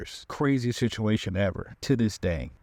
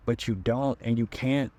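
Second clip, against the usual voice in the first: ordinary talk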